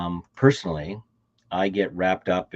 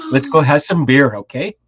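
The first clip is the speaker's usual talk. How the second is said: loud, jokey invite to drinks; playful, loud; 6 semitones higher, 11 dB louder than usual. Pretend. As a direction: call out cheerfully for beer.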